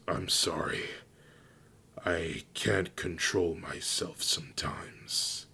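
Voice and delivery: soft, breath-heavy regret; subdued apology. A narrator's reading. Fenrir apologises after his outburst, soft and subdued. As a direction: apologise quietly, half whispered.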